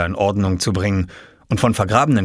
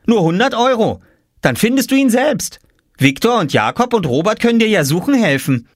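put-on higher cheeky child voice; offering; 12 semitones higher, 4 dB louder than usual. A narrator's reading. Oskar bargaining with Jule, cheeky. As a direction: offer it coolly.

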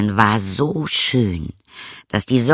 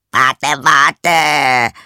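louder, higher frog cry to wait; frog calling out; 4 semitones higher, 6 dB louder than usual. Acted. Frog calling after the fleeing princess, loud and higher. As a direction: shout after her as she runs off. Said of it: "rief der Frosch".

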